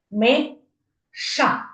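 "Миша" in two separated beats; syllable by syllable. Shown as a demonstration. Children count chin touches, word split syllable by syllable. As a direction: pause between Ми and ша. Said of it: "Давайте ще підкладаємо".

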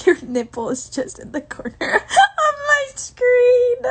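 slow, high, laughing through words. Real, not acted. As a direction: giggle through the whole line. Laughing while telling viewer.

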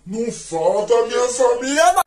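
put-on defiant kid cry. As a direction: drag out a hammed-up protest, building to the end. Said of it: "aí eu fiz assim".

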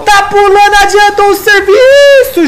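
excited shout. Real, not acted. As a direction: yell the fight as it happens. Fast teamfight call.

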